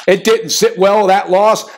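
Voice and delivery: ordinary delivery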